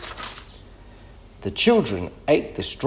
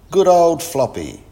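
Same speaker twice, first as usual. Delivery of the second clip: loud, high-pitched storybook cheer; cheering praise; 7 semitones higher, 7 dB louder than usual. A narrator's reading. Biff praises Floppy for chasing the cat, cheering. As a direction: praise with lifted, pleased energy.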